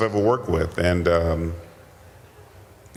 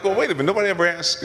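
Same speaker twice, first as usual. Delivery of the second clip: mock protest; 11 semitones higher, much faster than usual. Acted protest at being overlooked.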